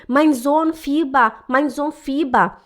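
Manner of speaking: slow, halting broken German; anxious mother on the phone in slow broken German; broken beginner German. Retold or imitated. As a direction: plead in bad German, word by word. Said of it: "eu falei".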